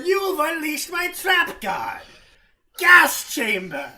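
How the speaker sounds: high loud card-game villain shout. Acted. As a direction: announce the trap card dramatically.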